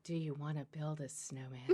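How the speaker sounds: low, soft seductive line through a laugh; sexy voice. Pretend. Reads a Frozen line to her co-host in a low sexy voice. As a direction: purr it while stifling laughter. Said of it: "sexy thing that should never".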